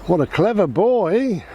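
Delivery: squeaky praising a dog. Real, not acted.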